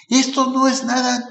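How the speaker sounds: put-on high, thin old man voice. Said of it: "voy a hacer la voz de viejito".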